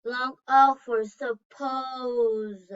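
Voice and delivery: slowly stretched, leaning on suppose; slow and careful. Shown as a demonstration. Teacher slowly giving the long O example. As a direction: spell it out slowly for learners. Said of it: "locate words with a long A sound".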